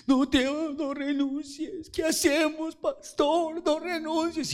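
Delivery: high, pleading mock wail; hammed-up high-pitched plea not to resign; crying leaders. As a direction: imitate the leaders begging through tears. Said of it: "comienzan a llorar los líderes".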